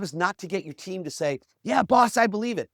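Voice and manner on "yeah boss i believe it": mock keen agreement, high and loud; eager yes-man. Team member telling the boss yes, mock eagerness.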